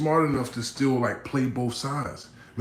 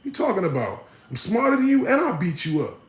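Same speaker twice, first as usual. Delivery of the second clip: much higher, loud boastful taunt with a laugh; cocky challenge; 12 semitones higher, 3 dB louder than usual. Retold or imitated. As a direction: dare them to try him. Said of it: "I would be like".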